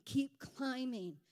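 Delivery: ordinary talk, nothing marked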